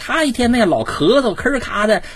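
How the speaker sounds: high-pitched, imploring mock caller; concerned caller asking. Listener asks Teacher Wang about a coughing neighbour, in a high-pitched pleading voice. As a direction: act out someone bringing a neighbour's problem to the expert. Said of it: "大家大事小情都找咱们来问".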